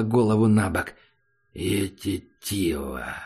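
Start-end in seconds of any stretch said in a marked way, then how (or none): 1.5-3.1 low, slow, much softer creature voice; monster grumble. Acted. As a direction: mangle it like a beast. Monster mimics the word.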